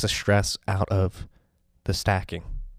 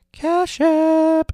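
announcer yell; 8 dB louder than usual. Acted. Sponsor name yelled out.

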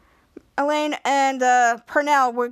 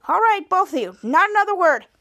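louder, higher scolding cutoff; acted stern mother; 5 semitones higher, 4 dB louder than usual. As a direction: silence two squabbling kids. Mother silences both children.

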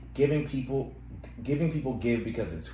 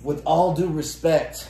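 slow, louder, put-on "With all due respect."; mock condescending; 5 semitones higher, 6 dB louder, slower than usual. Mocking a coworker's polite put-down, slow and snooty. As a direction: drawl it like a smug coworker.

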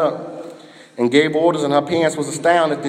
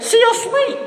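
leaps up high on the words; sudden high, light delivery; high Jesus quote. Acted. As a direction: lift it way up and light.

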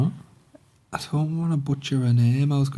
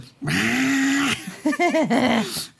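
high goofy laugh; silly laugh; 11 semitones higher than usual. Retold or imitated. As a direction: imitate his dopey laugh. Mimicking the guy's reaction.

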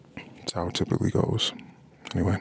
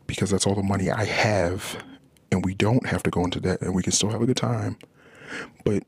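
ordinary delivery

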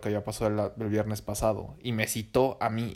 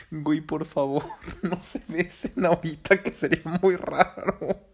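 higher, slower, laughing through a mock plea; 7 semitones higher, 4 dB louder, slower than usual. Retelling pleading with two kissing friends, laughing, higher and slower. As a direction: beg them through laughter, pitched up and drawn out.

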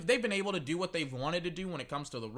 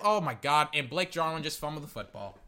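normal talking voice

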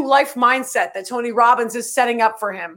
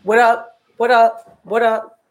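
ordinary delivery

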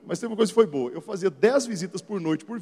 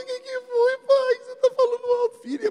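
put-on little girl voice. Daughter answering her dad's call, timid small child. Daughter startled by loud dad.

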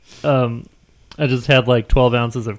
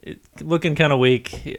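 ordinary talk

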